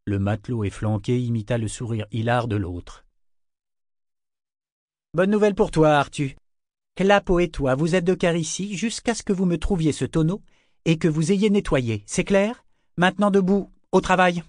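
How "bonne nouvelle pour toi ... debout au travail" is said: high, loud officer's command; peremptory and driving; barked orders. A narrator's reading. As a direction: lay down the law, voice raised. Officer orders sailors back to work.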